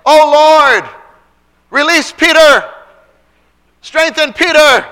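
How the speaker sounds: high, loud cry to the Lord; fervent shouted prayer. Acted. Church members pleading for Peter in a prayer meeting, fervent shout. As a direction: pray it at full force, slowing on the last plea. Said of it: "Don't you think they were praying".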